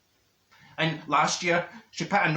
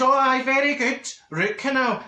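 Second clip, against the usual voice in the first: high, louder mock approval; sarcastic; 8 semitones higher, 4 dB louder than usual. Patient reacts to the dentist's excuse, sarcastic. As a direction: scoff at the excuse, pitched up.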